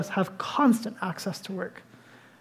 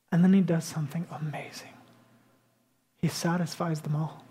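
hushed whisper; 4 semitones lower, 6 dB quieter than usual. Acted. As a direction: softly whisper the wonder. Preacher hushes for the miracle.

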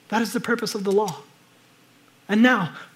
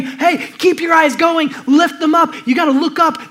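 higher, louder and slow, urging upward; slow, raised, pleading urge; urgent call; 7 semitones higher, 8 dB louder than usual. Retold or imitated. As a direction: call out to lift their eyes. Voicing the prophets' urgent call.

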